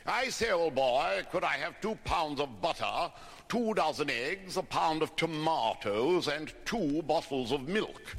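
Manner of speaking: slow, plummy English accent put on by an Irishman; best English accent. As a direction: order slowly in your poshest Oxford English. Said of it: "in his best English accent".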